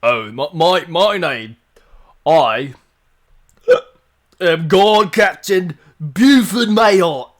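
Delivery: slightly gravelly guard captain; loud, slow proud guard introduction; Buford Mayhart. Acted. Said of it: "slight gravel for Buford Mayhart".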